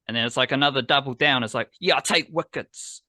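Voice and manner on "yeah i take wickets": "Yeah, I take wickets" said higher, with a hard aggressive punch. Acted. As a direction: spit the line out like a cocky rap boast. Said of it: "aggressive there".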